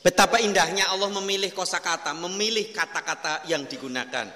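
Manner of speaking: usual speaking voice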